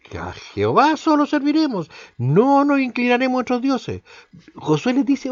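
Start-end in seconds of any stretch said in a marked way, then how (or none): 0.0-4.0 voicing the people. Preacher voices the people's vow.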